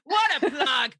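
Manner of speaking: laughing loudly at the plug. Real, not acted. Host laughing at the guest's shameless brand plug. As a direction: laugh it out, teasing. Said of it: "laughing at guest's plug".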